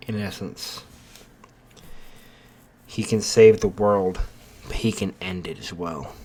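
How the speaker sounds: portentous storyteller, letting each phrase hang; ominous narration. A narrator's reading. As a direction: intone it like a foreboding prophecy.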